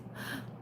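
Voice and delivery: short soft gasp. Real, not acted. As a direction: take a quick breath in. Reacts to a comment.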